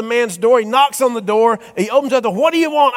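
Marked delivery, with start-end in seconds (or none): 2.3-3.0 put-on higher, irritated old man; exasperated old man. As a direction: snap at the pest again. Old man, third time.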